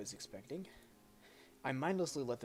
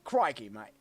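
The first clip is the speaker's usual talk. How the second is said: loud mock Australian accent; Steve Irwin; 9 dB louder than usual. Shown as a demonstration. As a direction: put on a broad, loud Australian twang. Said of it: "Steve Irwin's voice?".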